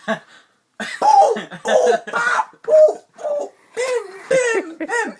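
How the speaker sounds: high, loud boom pop bim sound effects; imitated hitting noises. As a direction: act out each whack of the bat.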